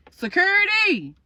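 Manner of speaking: high, slow, drawn-out call; acted calling out. Shop owner calling security over, drawn-out high call.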